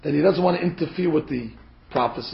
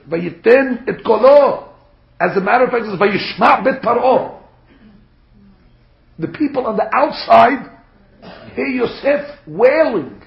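forceful preaching; 6 semitones higher, 7 dB louder than usual. Acted. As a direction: proclaim it emphatically to the whole congregation. Preacher proclaims the verse.

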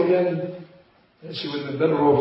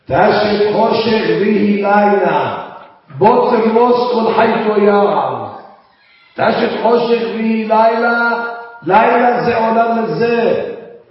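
louder, intoned preaching with sustained lines; fervent preaching; 7 semitones higher, 7 dB louder than usual. Acted. Rabbi preaches to listeners about this dark world, fervent preaching. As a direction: drive the verses home to the congregation. Preacher chants verses in full force.

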